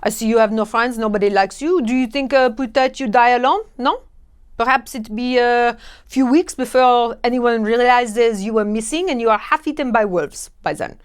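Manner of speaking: hammed-up French accent, snide. Taunting a lonely tourist, hammed-up French accent. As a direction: sneer in a thick French accent. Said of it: "they still have a French accent".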